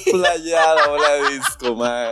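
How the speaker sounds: high-pitched, drawn-out squeal; mock gushing. Friend cracking up over the disco ball, mock gushing.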